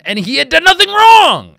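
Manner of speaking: high, loud cry of injustice; indignant outburst. Real, not acted. Host defending the arrested man to listeners, indignant outburst. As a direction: raise the voice sharply in indignant defense.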